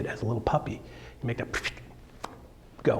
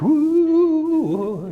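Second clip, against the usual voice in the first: excited ooh; 13 semitones higher, 4 dB louder than usual. Retold or imitated. Chasing fast on a bike.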